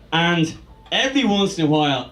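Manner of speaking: ordinary talk, nothing marked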